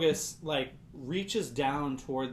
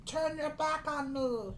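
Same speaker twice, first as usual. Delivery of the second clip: very high, otherworldly pleading voice; high strange voice in the head; 13 semitones higher, 5 dB louder, slower than usual. Acted. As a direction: plead from inside his head, high and eerie. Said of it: "This voice in your head says".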